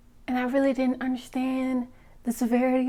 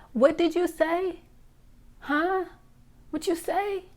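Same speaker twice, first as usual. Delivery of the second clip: mock confusion; 5 semitones higher than usual. Mocking men who mumble.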